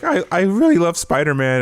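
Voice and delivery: put-on high squeaky teen voice. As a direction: squeak it out like a nervous kid. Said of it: "had the squeaky voice like this".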